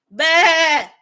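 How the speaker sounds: loud mock sheep bleat; a loud bleating baa. Imitating sheep after "bunch of sheep.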